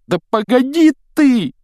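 very high, loud yell. A narrator's reading. Ron wrenches the wheel before the castle wall, yelling. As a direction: scream it out in panic. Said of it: "завопил Рон".

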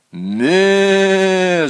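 high, loud bleating "Ме"; goat bleat. A narrator's reading. Goat answers the boy's command, bleating loudly. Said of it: "закричал Полкан".

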